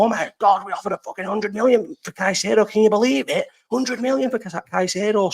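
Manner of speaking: high, gushing fan voice, clearly put on; over-the-top fan excitement, pitched well up; mock excited fans. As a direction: ham up a starstruck supporter bragging about the bid. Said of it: "All the fans go".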